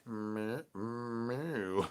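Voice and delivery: soft, quiet mewing cat; cat meow. Pretend. Cat answering the El Wushy call, soft meows. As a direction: mew back like a shy cat.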